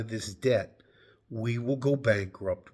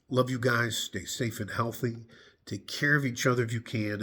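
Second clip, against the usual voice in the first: ordinary talk, nothing marked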